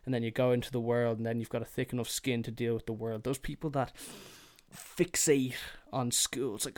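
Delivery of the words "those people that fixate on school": ordinary talk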